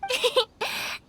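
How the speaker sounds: high cheerful little laugh; bright giggle. Acted. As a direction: giggle brightly, pleased with yourself. Amused by his praise.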